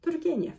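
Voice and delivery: Turgenev in Russian, rolled R; Russian pronunciation. Shown as a demonstration. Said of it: "the correct pronunciation in Russian".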